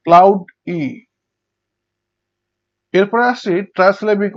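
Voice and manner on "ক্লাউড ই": ক্লাউড ই with a gap before ই; loud cloud, then a separate ই; syllable by syllable. Shown as a demonstration. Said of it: "আরেকভাবে ভাগ করা যেতে পারে".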